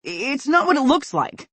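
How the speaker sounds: high, loud desperate denial. Acted. Caught son pleads with his mother, high and desperate. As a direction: blurt it out to save yourself. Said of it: "a desperate".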